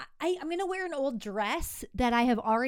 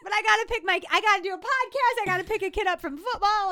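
higher and louder, overwhelmed listing; frazzled; 9 semitones higher, 6 dB louder than usual. Real, not acted. Swamped mom lists her chores to the co-hosts, frazzled. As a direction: pile up the chores in a stressed, raised voice.

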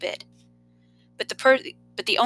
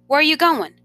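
higher, louder, startled ask; alarmed question; 8 semitones higher, 5 dB louder than usual. A narrator's reading. Boy to coach, startled and alarmed. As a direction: panic rising as coach gets out.

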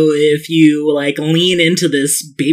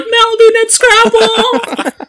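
excited fan yell; 4 dB louder, slower than usual. Acted. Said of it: "She's like".

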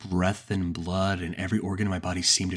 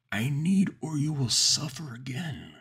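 a desperate monster pleading and warning; starved monster demand; 8 semitones higher, slower than usual. A narrator's reading. Starved monster threatens narrator.

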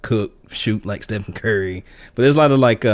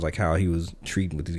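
usual speaking voice

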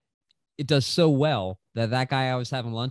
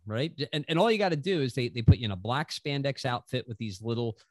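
ordinary talk

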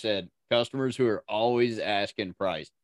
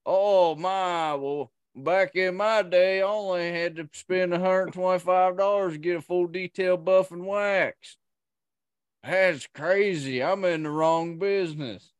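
put-on West Virginia accent; 6 semitones higher than usual. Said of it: "was that a West Virginian doing a West Virginian accent".

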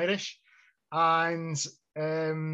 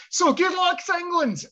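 much higher, louder shout for England; rousing cheer; 13 semitones higher, 4 dB louder than usual. Real, not acted. Host shouting good luck to England before the match. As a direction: cheer the team on. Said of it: "rousing sign-off shout".

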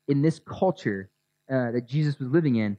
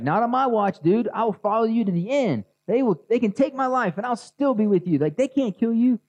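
higher, brash protest of loyalty; eager Peter; 8 semitones higher than usual. Retold or imitated. Peter insisting to Jesus he'll stay, high and eager. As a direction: swear you'll never let him die. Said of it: "And Peter's like".

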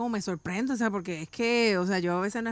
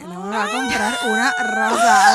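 hammed-up crying shout, much higher and louder, slow; mock wail; 12 dB louder than usual. Retelling to the hosts her boyfriend refusing the rose, mock wailing. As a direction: wail it like a heartbroken girlfriend. Disappointed she gets no rose.

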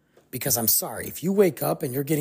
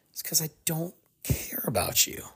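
cold whisper; 5 dB quieter than usual. Retold or imitated. Voicing the abusive ex-wife, a cold whisper. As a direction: breathe it out, no voice, chilling and flat.

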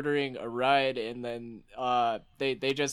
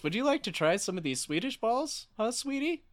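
flirty high voice; 9 semitones higher than usual. Acted. Improv flirting offering meatballs.

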